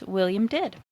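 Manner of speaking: ordinary delivery, nothing marked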